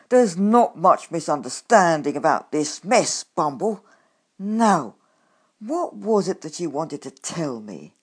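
loud, stern telling-off; stern reproach. Acted. Grumpkin confronts the badger about the flooded house, loud stern reproach. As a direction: lay into him, coldly angry.

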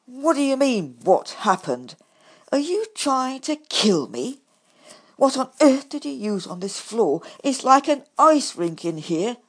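loud, indignant repeat of "what happened"; angry complaint. A narrator's reading. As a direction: throw his words back at him. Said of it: "I complained".

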